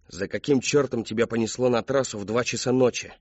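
plain reading voice

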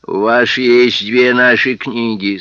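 slow, loud, menacing words; slow menacing policeman. Acted. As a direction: loom over him, slow. Library policeman voice.

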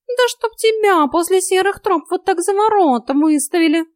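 high, loud, exasperated cat voice; cursing cat. A narrator's reading. Cat curses heroine's idea of turning newcomers out, exasperated. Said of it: "ругнулся Васька".

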